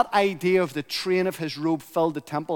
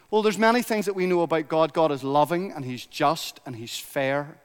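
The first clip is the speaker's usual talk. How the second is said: normal talking voice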